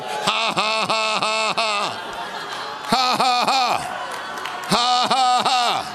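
high, emphatic forced ha-ha bursts; deliberate laugh of faith. Shown as a demonstration. Preacher leading the congregation in praise laughter, forced and high. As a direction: lead a hearty made-up laugh in volleys. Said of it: "I'm gonna go ahead and laugh".

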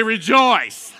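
"Rejoice" called out loud with a falling close; proclaimed rejoice. Retold or imitated. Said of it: "and again I say".

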